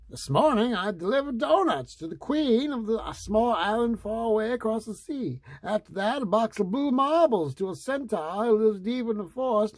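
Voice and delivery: high, chirpy delivery man; perky little courier voice, pitched well up. A narrator's reading.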